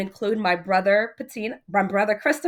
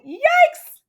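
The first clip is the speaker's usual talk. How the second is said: very high, loud startled yikes; shocked yelp; 11 dB louder than usual. Acted. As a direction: shriek in alarm. Father shocked again.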